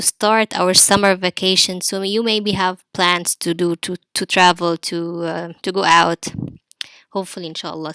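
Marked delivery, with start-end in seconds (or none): none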